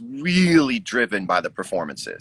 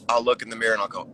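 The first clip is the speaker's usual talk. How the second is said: ordinary delivery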